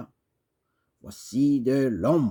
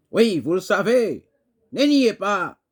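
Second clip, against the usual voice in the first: higher, forceful declaimed challenge; insistent accusation; 5 semitones higher, 4 dB louder than usual. Acted. Poet confronting the one who knows, insistent and raised. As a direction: press the charge, allowing no denial.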